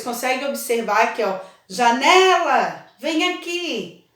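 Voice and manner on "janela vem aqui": slow, stretched call with a long né. Pretend. Pretending to summon colleague Janela from across the street, calling out. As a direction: hail someone far off, drawing out the vowels. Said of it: "Qual é o que eu falei com mais força?".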